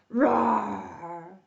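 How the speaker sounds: drawn-out play lion roar. Pretend. Storyteller plays the lion for young listeners, drawn-out roar.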